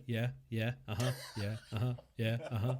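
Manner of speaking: low, soft, repetitive mumbled agreement; imitating own listening noises. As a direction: parody yourself nodding along, quietly. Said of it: "all I'm gonna be is like".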